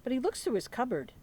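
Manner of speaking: lively picture-book read-aloud; reading to children. Acted. Teacher reading Thanksgiving book to children, lively read-aloud. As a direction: read it like story time for little ones.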